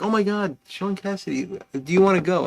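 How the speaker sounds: high, gushing wife voice; imitating his wife's excitement; mock excited wife. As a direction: gush like a thrilled fan. Wife quoted gushing about concert.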